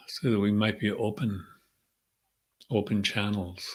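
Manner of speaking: calm, unhurried meditation voice with long pauses; guided meditation. Real, not acted. Leader centering the group before the session, calm meditative prayer. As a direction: settle them gently into the breath, leaving space between phrases.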